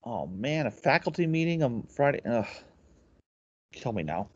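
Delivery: groaning dread, very soft; dramatic sigh. Real, not acted. Dreading a faculty meeting.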